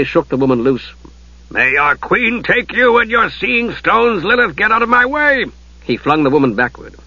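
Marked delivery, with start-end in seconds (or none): 1.5-5.4 impatient. Acted. Ariakan shaking Lilith off.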